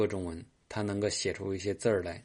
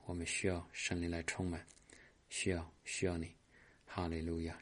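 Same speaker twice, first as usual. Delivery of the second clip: soft, murmured charismatic prayer; a pastor praying softly over the congregation; hushed prayer; 7 dB quieter than usual. Acted. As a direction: pray quietly, welcoming the Spirit. Leads a soft Spirit prayer.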